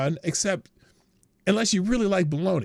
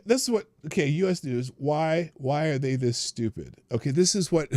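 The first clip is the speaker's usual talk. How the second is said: usual speaking voice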